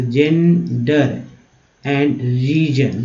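"gender and region" stretched out slowly; broken-down reading. Shown as a demonstration. Teacher reading words out for learners, slow broken-down "gender and region". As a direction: split "gender" and "region" into long, separate syllables. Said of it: "ऐसे तोड़ के पढ़ना है".

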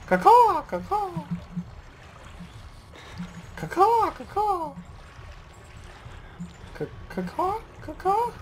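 high shrill crow caws; shrill screeching bird woman. Pretend. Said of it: "I like birds echolocate".

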